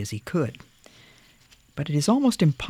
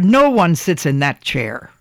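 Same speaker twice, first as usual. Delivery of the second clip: loud gruff command; bellowing; 3 semitones higher, 7 dB louder than usual. A narrator's reading. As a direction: keep bellowing the order. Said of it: "bellowed the woodcarver".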